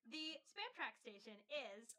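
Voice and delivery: ordinary delivery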